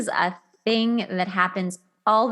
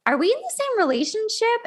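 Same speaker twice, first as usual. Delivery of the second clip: incredulous quote; 13 semitones higher than usual. Retold or imitated.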